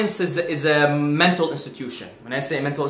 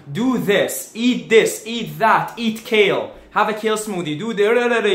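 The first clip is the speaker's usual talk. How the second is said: mocking advice; 6 semitones higher, 4 dB louder than usual. Mocking diet advice list.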